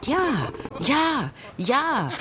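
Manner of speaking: high, excited yelps; high-pitched clamour of agreement; shouting antelopes. A narrator's reading. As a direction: cry out eagerly in a high voice. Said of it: "schrien die Antilopen".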